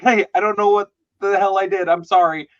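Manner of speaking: high, loud retold apology; frantic apology. Retelling his message after double booking both guests, frantic apology.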